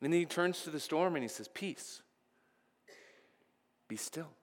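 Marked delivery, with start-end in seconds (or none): 1.5-4.3 hushed command. Retold or imitated. Jesus calming the storm.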